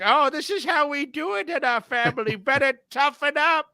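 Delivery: high, quick put-on relative voice; mock family elder. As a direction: play the hard-nosed relative, pitched high. Voicing the tough family's way.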